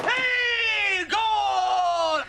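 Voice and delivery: high, drawn-out football cheer; triumphant high-pitched shout; goal celebration. Acted. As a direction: yell it out like you just scored.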